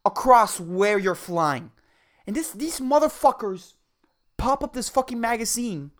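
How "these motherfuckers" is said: ordinary talk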